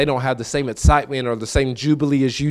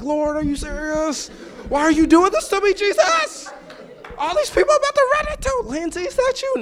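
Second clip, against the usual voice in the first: despairing wail. Retold or imitated. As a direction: cry out to Jesus in mock despair, way up high. Pleading with God, dreading.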